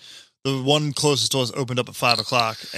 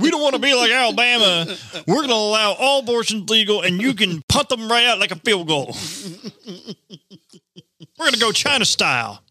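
high-pitched hammed-up proclamation; mocking Jersey; 8 semitones higher than usual. Comic voicing New Jersey announcing absurd policy, hammed-up. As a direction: declare it in a higher put-on voice. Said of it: "Jersey's gonna be like".

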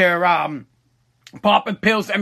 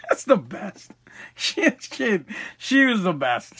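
quiet, breathless talk through laughter; can barely get the words out for laughing; cracking up while trying to tell the story; laughing helplessly; 5 dB quieter than usual. Real, not acted. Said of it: "I can't stop laughing about it".

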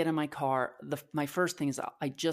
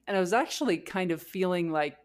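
ordinary delivery

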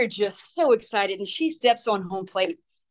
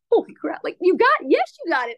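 excited; 7 semitones higher than usual. Retold or imitated. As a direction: burst out in delight. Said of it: "I'm like".

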